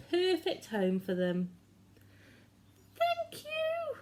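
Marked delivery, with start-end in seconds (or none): 3.0-4.0 squeaky mice. A narrator's reading. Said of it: "they squeaked".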